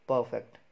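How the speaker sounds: "परफेक्ट" as PUH-fekt; falling, careful "परफेक्ट" without R; silent R. Shown as a demonstration. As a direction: stress the first syllable of "परफेक्ट", R dropped. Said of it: "आर जो है वो silent है".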